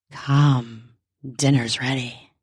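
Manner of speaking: very low character voice; beckoning wife. A narrator's reading. Wife leads husband to dining room, low beckoning.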